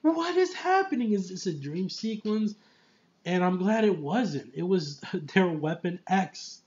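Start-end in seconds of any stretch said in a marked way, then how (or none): 0.0-2.5 much higher, bewildered cry; retold shock. Reviewer reliving his confusion in the theater, high and bewildered. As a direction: disbelief leaping up high. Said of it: "I'm just like".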